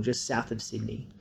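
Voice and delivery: usual speaking voice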